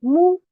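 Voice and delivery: teaching "mou." with a rounded dhamma vowel; syllable with dhamma. Shown as a demonstration. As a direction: model "mou." distinctly for the class. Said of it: "je prononcerai cette lettre".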